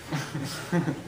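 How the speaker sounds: brief quiet chuckle; a small laugh to yourself; soft real laugh. Real, not acted. Preacher chuckling softly after calling them mythical creatures.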